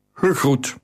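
lightly voiced foreign stranger's greeting; a hint of a deep accented voice; deep Kushite accent. Stranger greets from the dark hall, lightly accented. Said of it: "Zijn diepe stem had een accent".

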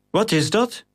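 high, startled call. A narrator's reading. Captain calls out at the arriving cage, startled. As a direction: call out to the crew in alarm. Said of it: "riep Gratus".

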